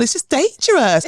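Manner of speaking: a polite English plea; proper English accent. Retold or imitated. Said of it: "I could hear myself sounding so English".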